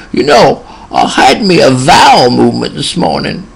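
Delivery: put-on high old country man voice; little old man confiding. Old patient leaning in to his nurse, confiding old man voice. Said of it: "dropped his voice a little quieter".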